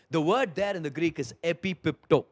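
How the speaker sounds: ordinary talk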